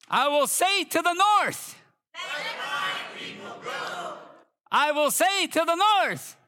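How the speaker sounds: high, drawn-out commanding call; preacher proclaiming with authority; scripture proclamation. Acted. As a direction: call it out slowly to the far corners.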